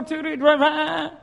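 put-on slow drawn-out child counting; slow stalling count. Boys stalling their count, slow. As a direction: drag it out to buy time. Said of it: "how they used to count".

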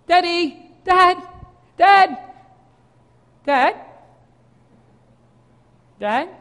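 put-on mildly anxious child calling; worried calling. Boys can't find dad, a hint of worry. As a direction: sound a little lost. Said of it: "the tone began to change".

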